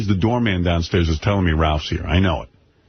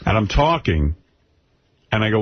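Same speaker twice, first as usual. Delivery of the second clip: usual speaking voice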